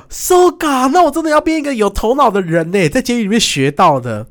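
high, loud mock delight at a discovery; hammed-up excited realization, pitched high and loud; mock eureka. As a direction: play it like a sudden hammed-up revelation.

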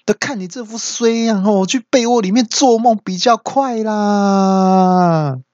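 very high, drawn-out retort; hammed-up put-down with a long stretched ending; scornful wife. Wife dismissing her husband's daydream, scornful.

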